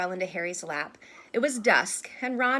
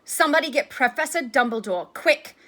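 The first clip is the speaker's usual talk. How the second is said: loud, higher, urgent order; suddenly sharp; 5 semitones higher, 8 dB louder than usual. A narrator's reading. Percy alarmed, sending someone for Dumbledore, suddenly sharp. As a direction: send for help with sudden sharpness. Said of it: "in a suddenly sharp voice".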